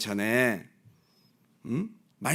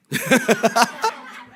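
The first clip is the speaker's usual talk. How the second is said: a loud burst of laughter; 7 semitones higher, 5 dB louder than usual. Real, not acted. Pastor laughing before telling the Netflix part. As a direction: crack up before the punchline.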